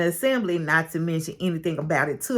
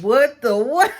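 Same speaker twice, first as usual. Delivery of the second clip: raised, louder stunned outburst; shocked disbelief; 5 semitones higher, 5 dB louder than usual. Retold or imitated. Teller reacting to what Gail revealed, shocked disbelief. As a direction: blurt it out in comic disbelief.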